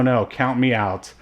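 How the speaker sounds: ordinary delivery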